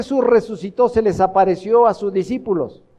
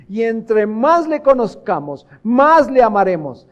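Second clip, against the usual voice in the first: raised, emphatic pulpit voice; impassioned preaching; 3 semitones higher, 3 dB louder than usual. Real, not acted. Preacher urging the congregation to know the Lord, impassioned preaching. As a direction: drive each question at the congregation.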